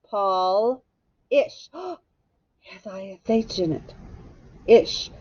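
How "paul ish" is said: "Paul-ish" drawn out with a pause before ish; split into syllables. Shown as a demonstration. Teacher spells it out for pupils, "Paul-ish" in two separate syllables. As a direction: break "Paul-ish" into Paul, then ish. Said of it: "First syllable".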